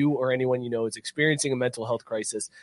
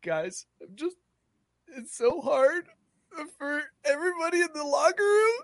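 high, hammed-up sobbing player voice; mock crying. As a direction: whine it like a blubbering athlete. Mock-crying football player in locker room.

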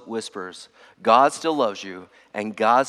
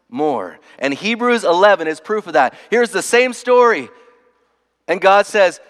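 raised, higher preacher's voice with punched key phrases; forceful preaching; 4 semitones higher, 4 dB louder than usual. Real, not acted. Pastor retelling Moses' story to the congregation, forceful preaching.

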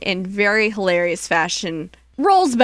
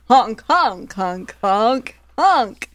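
Catherine Hepburn honking; 2 semitones higher, much slower than usual. Pretend. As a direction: honk like a shaky old movie star. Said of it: "this is my Catherine Hepburn".

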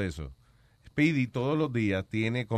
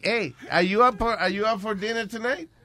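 mock eager invite; 7 semitones higher than usual.

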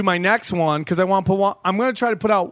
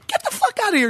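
high-pitched mock outrage; retold angry retort; 13 semitones higher, much faster than usual. Comedian dismissing a heckler, in a retold angry retort. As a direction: throw it back at the heckler, voice pitched way up.

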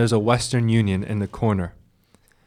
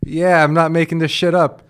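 exasperated retort; 5 semitones higher, 5 dB louder than usual. Retold or imitated. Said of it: "talking to her like this".

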